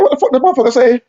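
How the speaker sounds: high, hurried panicked question; retold tearful disbelief. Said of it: "I went to crying".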